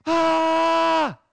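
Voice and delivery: loud, high-pitched shriek; acted startling yell; sudden crazed scream. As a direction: scream out of nowhere like a madman. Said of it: "all of a sudden he says".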